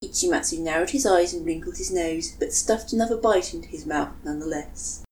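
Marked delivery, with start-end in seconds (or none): none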